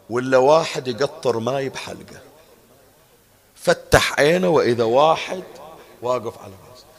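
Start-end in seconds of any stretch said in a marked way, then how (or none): none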